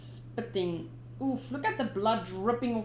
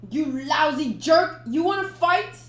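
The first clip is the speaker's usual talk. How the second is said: louder, higher taunt; angry challenge; 6 semitones higher, 8 dB louder than usual. Acted. Sanji challenging Gin, angry and loud. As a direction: snap at him to fight.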